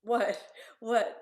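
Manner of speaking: disbelieving what. Retold or imitated. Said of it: "I said".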